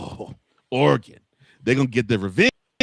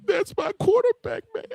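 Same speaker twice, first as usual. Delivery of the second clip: mock emotional fan. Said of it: "wrapped up in your emotion".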